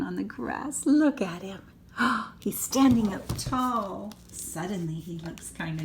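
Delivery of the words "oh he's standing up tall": ordinary delivery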